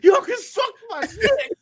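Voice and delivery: high, slow, squealing yell; mock shout. Answering Geeks and Gamers, high slow taunting yell. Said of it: "I'm like".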